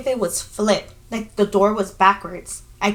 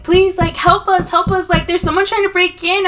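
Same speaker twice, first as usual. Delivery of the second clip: higher, louder retold plea for help; panicked pleading; 7 semitones higher, 4 dB louder than usual. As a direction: beg the front desk in acted panic.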